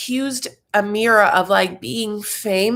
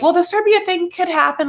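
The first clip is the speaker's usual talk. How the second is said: higher, brighter lawyer voice; lawyer's advice; 11 semitones higher, 3 dB louder than usual. Retold or imitated. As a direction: mimic the attorney, pitched up. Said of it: "they are like".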